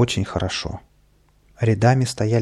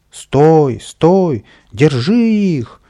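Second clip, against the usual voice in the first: much louder, higher, slow angry yell; loud shouting; 6 semitones higher, 8 dB louder, much slower than usual. A narrator's reading. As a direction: bellow after the runaways. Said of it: "громко кричал садовник".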